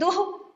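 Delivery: दुख with breathy h release at the end; दुख with a final breath-like visarga sound. Shown as a demonstration. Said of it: "ऐसे बोलते हैं".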